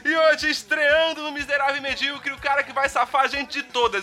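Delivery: upbeat show host intro; announcer voice. Acted. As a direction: announce the guest with fanfare. Host introduces podcast guest.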